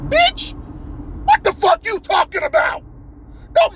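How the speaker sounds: high-pitched, incredulous shriek of a telling-off; outraged screech. Acted. Scolding a game character who claims to be a dad, outraged screech. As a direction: screech it at someone through the screen.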